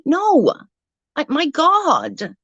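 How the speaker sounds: high, drawn-out disbelief; can't believe anyone would think that; exasperated. Real, not acted. Ranting to viewers about a critic, exasperated.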